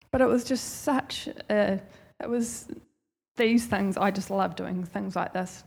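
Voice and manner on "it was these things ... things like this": normal talking voice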